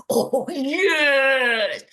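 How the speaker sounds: high, loud, laughing oh yes; gleeful delight. Retold or imitated. Checking Retraction Watch, she gloats loudly with delight. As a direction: relish the scandal with a laugh. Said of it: "I go there and I go".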